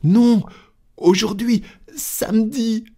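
very high, agitated outburst; frantic servant. Acted. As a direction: cry it out at the top of your pitch. Frantic servant contradicts master.

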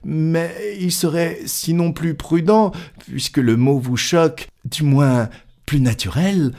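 high-pitched, tentative reasoning; hesitant Passepartout. Acted. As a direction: try gently to finish the point, pitched high. Voices Passepartout trying to reason.

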